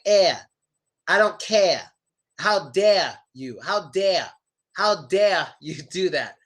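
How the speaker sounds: "air", "care", "dare" ending in "uh". Shown as a demonstration. Teacher showing places without final R, R dropped. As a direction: drop every final R, as in "dare". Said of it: "they really don't give it an R sound".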